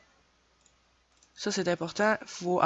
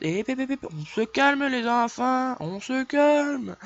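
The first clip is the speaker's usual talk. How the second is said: mock calming kids; 7 semitones higher, 3 dB louder, slower than usual. Playful hushing of imagined kids.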